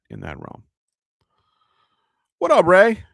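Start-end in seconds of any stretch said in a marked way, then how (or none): 2.4-3.0 excited greeting. Real, not acted. Greeting a viewer loudly.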